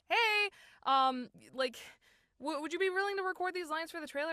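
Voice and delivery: mock cheerful request. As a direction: act out an eager ask. Imitating the casting team's request.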